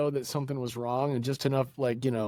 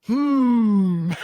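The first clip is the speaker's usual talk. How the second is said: suspicious hmm; 9 semitones higher, 8 dB louder than usual. Retold or imitated. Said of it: "we're like".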